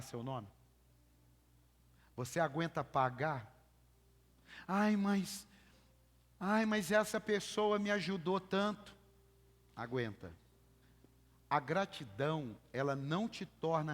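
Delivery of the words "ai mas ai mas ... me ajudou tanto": higher, louder whining excuse; hammed-up complaint from a friend; mock whiny plea. Preacher mocking a church member's excuse, whiny.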